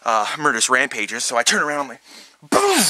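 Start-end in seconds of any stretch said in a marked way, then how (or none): 2.4-2.8 explosion sound effect. Retold or imitated. Firing the rocket launcher.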